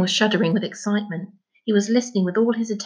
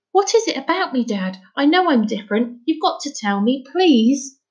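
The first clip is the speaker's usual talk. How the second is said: higher-pitched, urgent appeal; pleading boy; 6 semitones higher than usual. Acted. Son begging his father to explain, pleading. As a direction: beg Dad for an answer in a boy's high voice.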